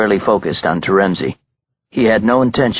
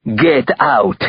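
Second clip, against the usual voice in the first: put-on higher-pitched, firm Russian-accented command; gunman's order; 4 semitones higher than usual. A narrator's reading. Belova backs up and orders Terenzi out, commanding.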